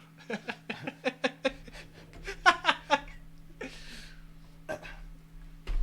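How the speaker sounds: long high breathless laugh; wheezing laugh. Real, not acted.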